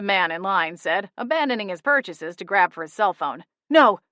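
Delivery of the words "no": loud, sharp no, higher; Holly stopping the man's call, barking; barked no. A narrator's reading. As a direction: bark it to stop the call. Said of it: "Holly barked".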